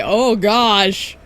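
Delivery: loud panicked "oh no" then a laugh; real startled yelp; real alarmed outburst. Real, not acted. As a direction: cry out as the game goes wrong. Reacting to game screen.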